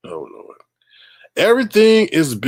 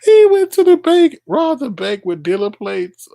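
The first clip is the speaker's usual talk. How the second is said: high, loud disbelieving squeal; incredulous outburst; 11 semitones higher, 7 dB louder than usual. Real, not acted. Streamer reacts to the robber's dealer plates with a high, loud incredulous squeal. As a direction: shriek it in disbelief, then come down.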